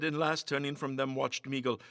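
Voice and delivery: plain reading voice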